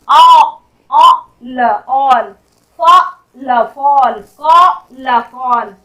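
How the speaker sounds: "all", "fall", "call" in loud separate sounds, then whole; sounding out. Shown as a demonstration. Teacher showing the sound of A, sounding out "all", "fall", "call". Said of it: "दूसरा है ए का sound".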